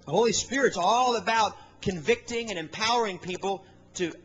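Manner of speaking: normal talking voice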